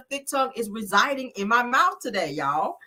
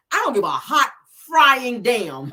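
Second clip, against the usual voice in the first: sassy emphasis; 2 semitones higher, 5 dB louder, slower than usual. Real, not acted. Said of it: "I'm gonna say it that way".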